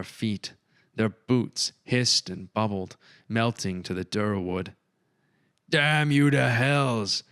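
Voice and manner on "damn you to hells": furious curse. A narrator's reading. Reginald cursing the wardling.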